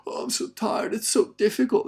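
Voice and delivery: high, loud mock complaint; mock whining.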